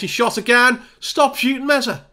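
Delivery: high-pitched scolding of a player; exasperated outburst. Real, not acted. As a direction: cry out in frustration at the repeated mistake. Player ignores him and shoots again.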